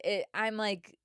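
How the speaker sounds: ordinary delivery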